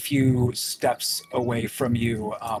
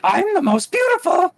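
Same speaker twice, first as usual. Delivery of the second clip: very high, loud preening squeal; vain creature voice; 7 dB louder than usual. Acted. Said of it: "first one you met is like".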